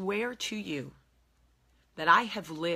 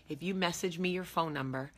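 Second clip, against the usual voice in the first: ordinary delivery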